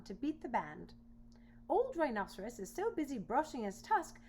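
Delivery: indignant boar. Acted. Boar grumbling that the animals are too busy. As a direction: complain like a huffy boar.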